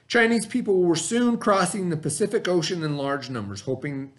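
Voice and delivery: steady read-aloud of the textbook. A narrator's reading. Teacher reading the lesson text to the class, read-aloud voice.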